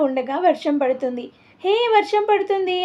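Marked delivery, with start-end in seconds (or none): 1.6-2.8 higher, bright cry of delight at the rain; happy shout. A narrator's reading. Girl cheering as the rain starts, excited shout. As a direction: call out happily, like a child splashing. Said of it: "హ్యాపీగా అరుస్తూ".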